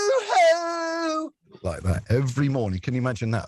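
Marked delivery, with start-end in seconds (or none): 0.1-1.3 very high, loud sung yoo-hoo; yoo-hoo cry. Retold or imitated. DJ greeting the girl on air, very high loud yoo-hoo. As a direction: hoot it out high and long. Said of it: "And I used to go".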